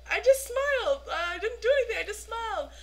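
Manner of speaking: high-pitched innocent protest; mock protest. Itty defends herself to the star, high mock protest. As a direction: imitate a friend squealing in self-defense.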